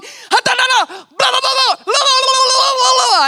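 put-on high, loud gibberish like a yelling five-year-old; mock a kid babbling at you at full volume; imitating a screaming child. Mimicking the boy yelling at her with a stick, high and loud. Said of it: "a five-year-old screaming at me".

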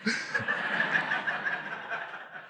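long, soft wheezing laugh; guilty laugh. Real, not acted. Dad admitting he enjoyed the mishap, soft guilty laugh. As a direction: laugh it out softly, real amusement. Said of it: "I'm laughing 'cause I'm partially sick".

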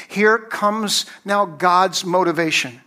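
ordinary talk, nothing marked

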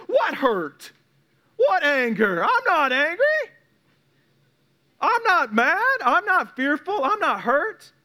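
high-pitched, hammed-up innocent protest; mock denial. Person ignoring their own hurt and anger, mock denial. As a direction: play someone in denial, voice jumping up high.

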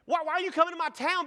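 high, loud, fast mock outrage; indignant. Acting out defending his hometown.

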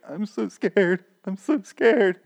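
high-pitched mock frightened child; scared little boy. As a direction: whimper like a hammed-up scared kid. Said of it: "Keep in mind, he's really, like, eight".

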